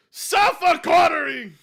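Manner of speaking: loud, slow taunting yell; mocking shout. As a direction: bellow it out to mock.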